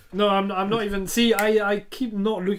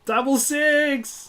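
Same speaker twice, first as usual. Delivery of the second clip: higher, louder, slow delight; excited; 8 semitones higher, 7 dB louder, slower than usual. Real, not acted. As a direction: savour the lucky roll. Rolling double six.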